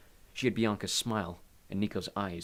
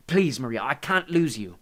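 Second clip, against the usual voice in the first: loud, higher, urgent plea; desperate plea; 4 semitones higher, 8 dB louder than usual. A narrator's reading. Hades begs Maria not to leave him, desperate. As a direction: beg a loved one desperately.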